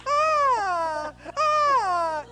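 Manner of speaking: put-on piercing high toddler shriek; kid screaming. Mocking a child's restaurant scream.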